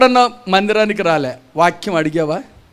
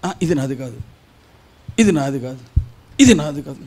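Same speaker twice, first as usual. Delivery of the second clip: mock dismissive churchgoer; 7 semitones lower, 5 dB quieter than usual. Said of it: "కొంతమంది ఏం చేస్తారు తెలుసా".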